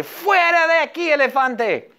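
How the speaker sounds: high, half-playful yell at the elephant; mock yell. Acting the girl yelling at her elephant, mock yell. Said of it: "Ella grita al elefante".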